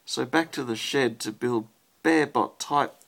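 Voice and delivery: rhythmic storybook read-aloud; reading to a child. Acted. Parent reads rhyming robot book to child, lilting read-aloud. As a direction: read the rhyme to a little one at bedtime.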